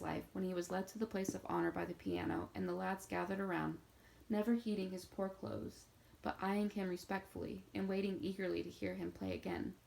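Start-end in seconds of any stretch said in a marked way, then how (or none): none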